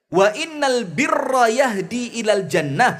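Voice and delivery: pembacaan hadis lambat dan berirama; hadith recitation. Acted. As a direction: lantunkan hadis dengan khidmat. (ustadz membacakan hadis kepada jamaah, melantun pelan)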